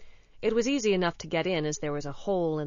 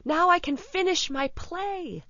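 higher, rising excitement of a student; delighted cry; 7 semitones higher, 4 dB louder than usual. A narrator's reading. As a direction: exclaim with growing joy. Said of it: "he cried".

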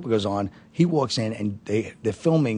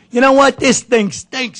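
loud high snarl, slowing way down on the last words; fed-up comic trashing the shoot, loud and slow; disgusted outburst; 13 semitones higher, 8 dB louder, slower than usual. Retold or imitated. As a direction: spit out your contempt, slowly. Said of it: "He starts screaming".

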